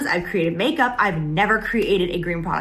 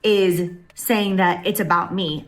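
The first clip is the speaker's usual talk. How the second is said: ordinary talk, nothing marked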